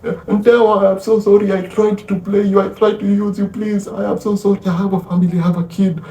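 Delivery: high, frantic acted begging; desperate screaming plea. Stabbed man begs Ntewa for help, high mock-desperate pleading. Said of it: "Abel is screaming his lungs out".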